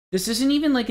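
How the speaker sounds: high, exasperated outburst again. Real, not acted. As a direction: pitch up in disbelief. Repeated outburst.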